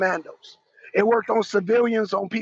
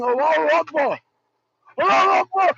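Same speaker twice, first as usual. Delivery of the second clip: higher mock battle cry; mock yell; 4 semitones higher than usual. Mocking fighters who yell their position, mock yell. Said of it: "when you're yelling".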